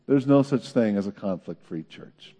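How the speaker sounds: usual speaking voice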